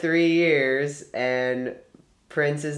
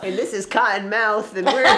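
higher, louder laughing DJ intro; DJ persona; 7 semitones higher, 6 dB louder than usual. Pretend. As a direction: jump in as the co-host DJ, cracking up. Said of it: "your super DJ name".

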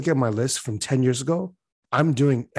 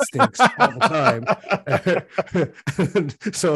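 laughing; 5 semitones higher, 3 dB louder, slower than usual. Real, not acted. Joking about his wife, laughing.

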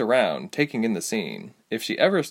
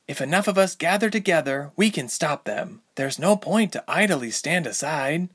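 earnest heroine voice; 7 semitones higher than usual. A narrator's reading. Ilse pleads her case.

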